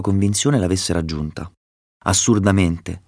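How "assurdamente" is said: usual speaking voice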